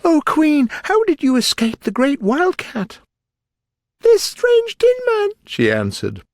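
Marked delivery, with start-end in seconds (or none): 0.0-3.0 high squeaky mouse chorus; little mice greeting their queen; excited mice. A narrator's reading. 4.0-5.3 very high squeaky queen voice; tiny regal squeak; proud little queen; mouse queen. A narrator's reading.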